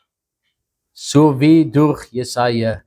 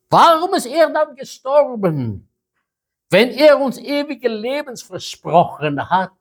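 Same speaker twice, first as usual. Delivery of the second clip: higher, pleading questions; anguished disciples; 9 semitones higher than usual. Acted. Disciples asking Jesus why he died, pleading and high. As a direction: cry out why in disbelief.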